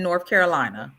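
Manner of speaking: normal talking voice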